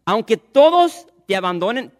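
loud, high proud declaration; proud vow. Acted. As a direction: swear it loud with wounded pride. Peter's proud vow to Jesus.

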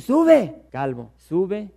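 put-on a jeering boy calling after the old man; mocking taunt. Boys taunting Eliseo to go up, yelled then drawled. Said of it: "es lo que le están diciendo".

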